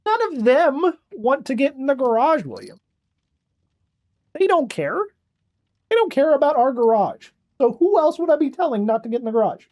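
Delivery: high exasperated pet talk; pet scolding. Real, not acted. Owner lectures cat William about the garage, high-pitched.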